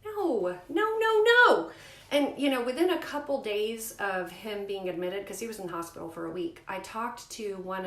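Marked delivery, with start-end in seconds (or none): 0.0-1.7 rising, much louder and higher no; emphatic no. Real, not acted. Mother rejecting needless surgery, rising loud no. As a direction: shut the idea down hard.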